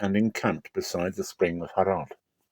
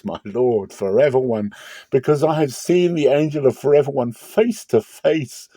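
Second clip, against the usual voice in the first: rising, louder cry of dread; awestruck dread; 5 semitones higher, 4 dB louder than usual. Acted. Gideon crying out to God, awestruck and afraid. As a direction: cry out as if you've seen death coming. Gideon realizes he saw the angel.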